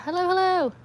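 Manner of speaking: high-pitched sing-out hello; excited greeting. Real, not acted. Greeting Micah joining the live, excited high hello. Greeting a viewer who joins.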